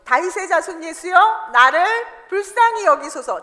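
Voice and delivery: loud, pleading cry with a rise on 예수여; crying out to Jesus. Retold or imitated. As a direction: call out to him as a blind beggar would. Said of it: "뭐라고 소리를 지릅니까?". (다급하게 외치는 목소리)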